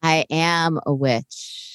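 low slow witchy voice; slow, low, menacing witch. Acted. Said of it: "You sounded like a witch".

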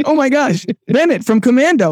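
thrilled to place the actor; excited recognition. Retold or imitated. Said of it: "I was like".

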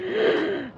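a sudden high gasp of alarm; sharp gasp. Acted. Seeing Daniel go limp, a sharp frightened gasp. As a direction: gasp in shock as he collapses. Sudden shock, partner collapses.

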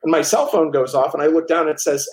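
ordinary talk

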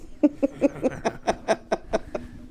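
high breathless wheeze of laughter; cracking up at his own punchline; wheezing laugh. Real, not acted. Joke teller cracks up at his own punchline, wheezing laugh.

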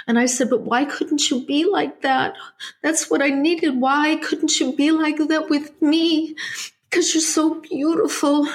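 high, teary voice that holds all through; still crying. Real, not acted. Guest, still in tears, tells host what her mother said. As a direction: keep telling it through real tears. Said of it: "the tears that I'm experiencing now".

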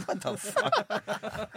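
slow, high baffled what the fuck. Retold or imitated. Friend quoting the movie woman, baffled and drawn out. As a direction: stretch it out in high disbelief. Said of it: "It's just like".

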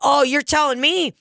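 hammed-up indignant agreement; mock outrage. To the old regular about Fresca, hammed-up outrage. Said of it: "I go".

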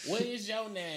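high girly imitation. S1 mimicking the girl.

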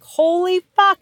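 high, startled swear; shocked curse. Retold or imitated. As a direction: blurt it in disgusted alarm. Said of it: "And you're like".

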